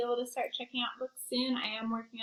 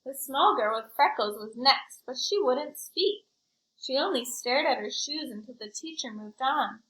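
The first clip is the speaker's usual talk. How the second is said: put-on lively read-aloud for kids; story narration; 3 semitones higher, 5 dB louder, slower than usual. A narrator's reading. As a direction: tell it like a picture book to a class. Reads picture book to children.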